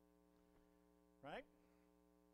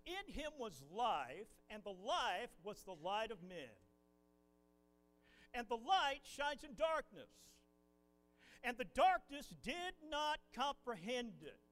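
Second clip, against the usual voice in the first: solemn scripture reading; 5 dB louder, slower than usual. Acted.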